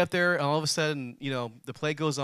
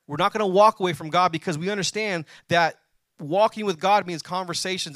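full-force preaching; 4 semitones higher, 6 dB louder than usual. Acted. Pastor reading Joshua to the congregation, preaching hard. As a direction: thunder the verse out to the congregation.

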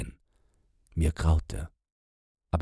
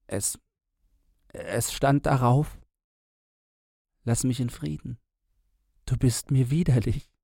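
hoarse whisper; 8 semitones higher than usual. Acted. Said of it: "Dann flüsterte er heiser".

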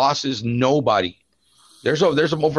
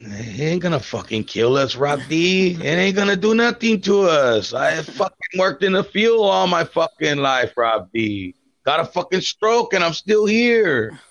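higher, slower tough old uncle, lightly put-on voice; boastful Uncle Ruben impression; 6 semitones higher, slower than usual. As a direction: brag like a stubborn old field worker who can't be killed.

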